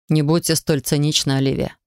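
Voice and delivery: ordinary delivery, nothing marked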